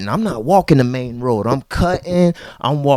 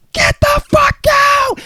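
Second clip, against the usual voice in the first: far higher, shrill screamed order; hammed-up furious yell; mock screaming. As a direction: scream it like she's losing it. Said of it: "starts to yell and scream".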